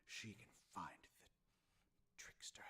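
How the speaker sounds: normal talking voice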